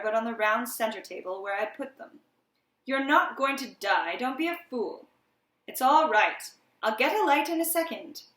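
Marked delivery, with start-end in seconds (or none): 2.8-8.2 impatient reassurance. A narrator's reading. Narrator snaps at panicking friend.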